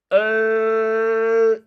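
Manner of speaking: 얼 held long and loud, air out by the tongue's sides; lateral L sound. Shown as a demonstration. Teacher demonstrating the lateral sound, long held L. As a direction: press the tongue up and sustain 얼. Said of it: "이런 발음입니다".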